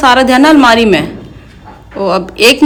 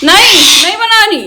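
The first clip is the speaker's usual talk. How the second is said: voicing the parrot; 10 semitones higher, 3 dB louder, slower than usual.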